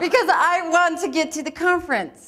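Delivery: an overeager improv partner agreeing through laughter; eager yes. Acted.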